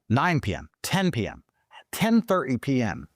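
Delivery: ordinary delivery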